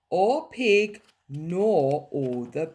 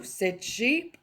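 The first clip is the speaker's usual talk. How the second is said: plain reading voice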